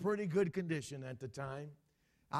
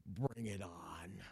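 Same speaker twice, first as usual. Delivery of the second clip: low, slow defiant growl; defiant man; 8 semitones lower, much slower than usual. Retold or imitated. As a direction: dare the world low and slow. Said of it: "That man would say".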